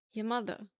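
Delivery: New York "mother" as "mutha"; light New York "Your mother"; New York accent. Shown as a demonstration. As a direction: drop the r, New York style. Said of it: "adding the A".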